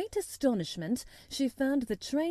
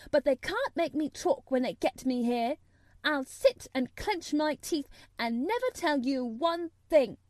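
higher-pitched, fiercely stubborn; fierce defiance; 5 semitones higher, 4 dB louder than usual. A narrator's reading. Mary defying Colin's threat, fierce. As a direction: dig in and defy him. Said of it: "said Mary fiercely".

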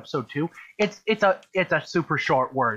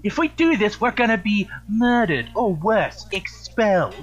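Hermione impression; 5 semitones higher, 3 dB louder than usual. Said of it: "That's big".

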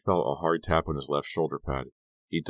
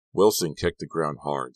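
plain reading voice

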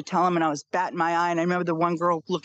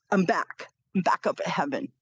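normal talking voice

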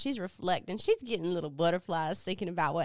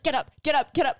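louder, higher mock urging; urgent repeated command; 5 semitones higher, 6 dB louder, faster than usual. Retelling his horror at his mistake, urgent command. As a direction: hurry her to her feet. Retold man frantically orders her up.

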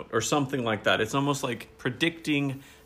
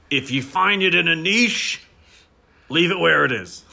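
mock grand proclamation; 4 semitones higher, 5 dB louder than usual. Friend recites the second line for laughs, in a loud pompous voice. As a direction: declaim it like a pompous town crier. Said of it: "he has another one".

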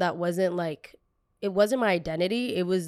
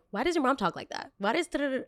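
higher, mock-innocent kid voice imitating classmates; 7 semitones higher, faster than usual. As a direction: lightly play the nosy little kids, pitched up. Kids mocking mom's accent.

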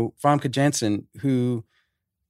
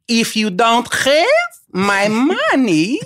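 much higher, slow, louder hammed Russian villain voice; Malkovich's Russian accent; 5 dB louder, much slower than usual. Imitating Malkovich's Russian accent across the poker table. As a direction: stretch every word like a menacing Russian gangster. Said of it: "he goes".

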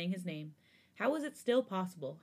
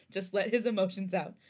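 plain reading voice

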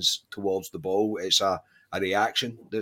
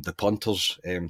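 ordinary talk, nothing marked

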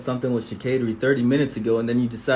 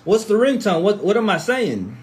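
ordinary talk, nothing marked

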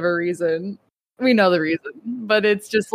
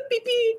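high squeaky beeps; 13 semitones higher, 5 dB quieter than usual. Pretend. Mock alarm sound.

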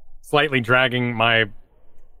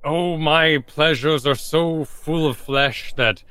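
slow, higher-pitched theatrical boasting; grand refusal; 5 semitones higher, slower than usual. Acted. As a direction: draw it out with lofty drama. Varel grandly refusing the woman's advances.

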